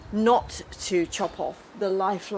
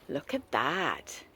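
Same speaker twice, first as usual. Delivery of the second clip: low drawn-out; 7 semitones lower, 8 dB quieter, much slower than usual. Real, not acted. Showing off street treasure.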